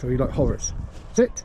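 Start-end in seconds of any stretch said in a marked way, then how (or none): 1.1-1.4 sharp call. Real, not acted. Trainer commands dog to sit.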